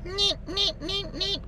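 high, soft little neat neat; tiny high-pitched neats; small high neat. Shown as a demonstration. Showing the small sound before the fry, high soft neats. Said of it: "small little".

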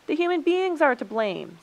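high, loud accusation; heated storybook quarrel; accusing. A narrator's reading. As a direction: pin the blame on them loudly.

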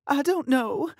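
high, loud defensive retort; spat back. Acted. Sister snapping at the fiancé, sharp retort. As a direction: snap it back at him. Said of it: "Bree spat at him".

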